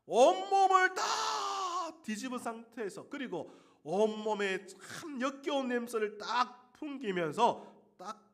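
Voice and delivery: high, loud preacher's cry, then dropping; full-force preaching. Acted. Pastor preaching to congregation, shouting then sinking.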